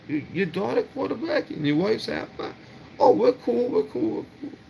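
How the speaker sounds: high, sarcastic mock-girly voice, trailing to a breathy whisper; mocking the girl. Imitating the girl who suddenly backs off, high and mocking. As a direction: play her sudden fake friendliness, way up high.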